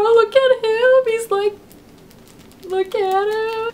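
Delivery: high, slow squealing over something cute; excited gushing. Real, not acted. Gushing over big Totoro.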